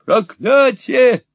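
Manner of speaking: high, slow muffled shout; high slow muffled cry. Acted. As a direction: cry out from under the blanket. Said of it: "приглушённым голосом крикнул он".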